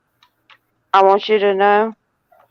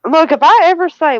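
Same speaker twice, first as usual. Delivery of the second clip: ordinary talk, nothing marked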